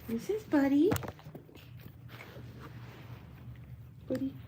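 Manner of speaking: high, sing-song pet talk; baby talk to dog. Acted. Showing her puppy to viewers, high doting pet talk.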